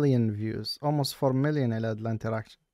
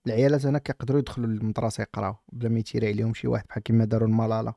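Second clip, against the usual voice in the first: ordinary talk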